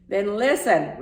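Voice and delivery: loud roared order; outraged caller roaring at the clerk. A narrator's reading. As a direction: bellow it down the phone. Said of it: "roared Mister Popper".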